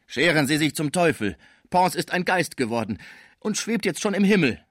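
put-on grieving old man shouting at the salesman; angry outburst. A narrator's reading. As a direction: drive the salesman out in grief. Schmucke throws salesman out.

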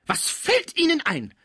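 very high, slow, indignant exclamation; outraged cry. Acted. Cibot rounds on Pons for questioning her, outraged cry. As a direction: stretch it out in shrieking outrage. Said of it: "rief die Cibot".

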